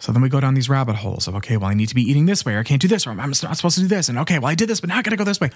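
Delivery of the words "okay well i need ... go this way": rushed, climbing list of worries; spiralling, hurried inner monologue; fretful self-talk. Acted. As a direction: rattle off mock worries, pitch rising as you go. Voicing frantic rabbit-hole self-talk.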